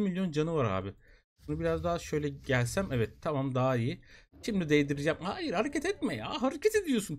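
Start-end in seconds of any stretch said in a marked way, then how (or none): 5.2-7.2 high frantic plea. Real, not acted. Pleading with tamed pet.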